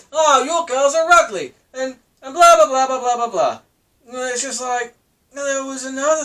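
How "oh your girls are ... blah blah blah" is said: a lightly voiced jeering Perry, a bit higher; hammed-up schoolyard taunt trailing into blah blah; mock taunting. Mocking Perry's taunt at Darren Till, lightly jeering. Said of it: "he's just basically saying".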